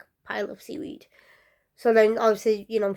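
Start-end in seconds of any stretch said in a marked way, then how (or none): 0.0-1.1 whispered aside. Real, not acted. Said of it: "whispered aside".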